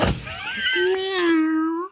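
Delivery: high wailing cat meow; mock cat yowl; cat impression. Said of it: "I'm giving you my best cat".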